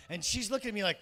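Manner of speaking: normal talking voice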